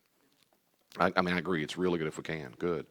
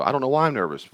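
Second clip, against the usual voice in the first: higher and louder, imitating a worried person; quoted nervous outburst, raised and louder; mock nervous voice; 7 semitones higher, 6 dB louder, faster than usual. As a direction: act out the panicky thought, a little overdone. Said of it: "You might go".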